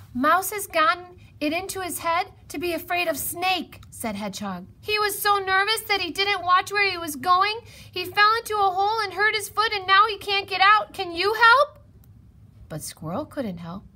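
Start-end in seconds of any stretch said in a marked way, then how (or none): 0.1-3.8 high, loud urgent report; worked-up high voice; agitated hedgehog. A narrator's reading. Hedgehog urgently tells Squirrel the news. 4.8-11.8 high, loud, breathless rush; frantic high-pitched plea; frantic hedgehog. A narrator's reading. Hedgehog pleads with Squirrel for help.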